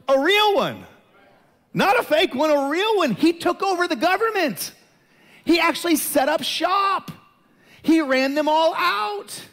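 loud, high-pitched emphatic preaching; fiery preaching. Real, not acted. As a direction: preach it at full force, punching each short line. Preaching builds to full force.

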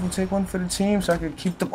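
normal talking voice

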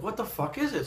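high, hushed startled question. Retold or imitated. Rich spots a strange shape in the photo, startled and high. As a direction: pitch it up like a jolt of alarm.

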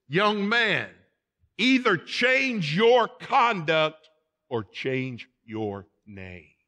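loud, high and slow, dropping at the end; acted commanding ultimatum. King passing judgment on the young deserter, commanding and drawn out. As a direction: proclaim each word, then let it fall.

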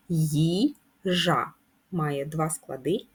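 Їжа drawn out slowly, syllable by syllable; slow syllable demo. Shown as a demonstration. Teacher to pupils, slowly sounding out Їжа syllables. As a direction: spell out Їжа slowly for the class. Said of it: "наголос на перший склад".

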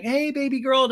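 high, cooing mock greeting; high-pitched put-on greeting; gushing greeting. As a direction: imitate someone gushing hello, way up high. Acting Charlie's greeting to pets.

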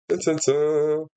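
ordinary talk, nothing marked

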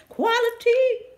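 high, drawn-out hype; excited. Real, not acted. Vlogger savors the word quality, excited. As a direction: stretch the word with delight.

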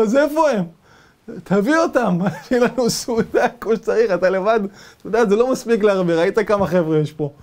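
high, loud cackling taunt; mocking chief. Cannibal chief taunting the captive, high and laughing. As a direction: gloat over the meal.

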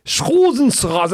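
exaggerated German repeat for a baffled listener; German uncle repeating.

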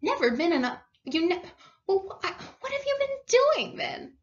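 open-mouthed, incredulous stammer; astonished. A narrator's reading. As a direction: sputter in amazement, breaking off and restarting. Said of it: "open-mouthed".

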